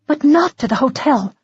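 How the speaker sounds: urgent, a touch out of breath; lightly gasping woman; gasped. Acted. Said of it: "she gasped".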